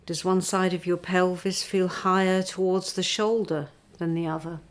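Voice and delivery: unhurried meditation teacher; guided body scan. Acted. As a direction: lead them gently into stillness, breath by breath.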